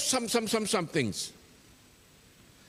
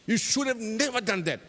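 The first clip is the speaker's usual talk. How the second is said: raised, scolding reproach; blaming; 6 semitones higher than usual. Acted. Chope blaming Tabale for lifting the lid, scolding. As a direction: tell him off for what he did.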